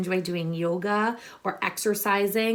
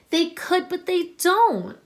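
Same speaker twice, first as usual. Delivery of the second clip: higher and louder, leaning on could and don't; emphatic turn; 8 semitones higher, 5 dB louder than usual. Acted. Teacher reading the moral to her class, higher and louder on could and don't.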